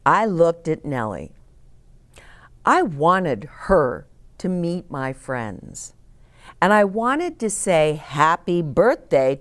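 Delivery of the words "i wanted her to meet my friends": ordinary delivery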